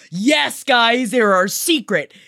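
high, excited proclamation; emphatic confirmation. Acted. Host confirming the secret clan to listeners, excited proclamation. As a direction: proclaim the absurd twist at the top of your range. Emphatic answer to own question.

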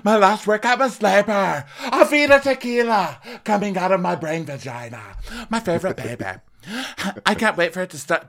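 high, loud Lisa bragging; mock Lisa. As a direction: brag about the baby like Lisa. Voicing Lisa in the class.

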